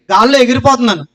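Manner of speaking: normal talking voice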